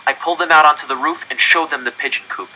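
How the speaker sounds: plain reading voice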